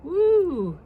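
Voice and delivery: high, loud joyful whoop; excited whoop. Real, not acted. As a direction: cheer like you just saw magic. First crystals form on bubble.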